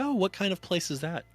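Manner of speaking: mock prying. Retold interrogators prying further.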